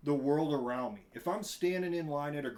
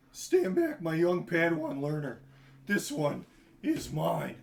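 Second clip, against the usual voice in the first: cartoon villain quote; 2 semitones higher, 6 dB louder than usual. Quoting the Brock fight scene, loud and grand. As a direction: boom out the line like a cartoon hero. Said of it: "when they're fighting Brock Samson".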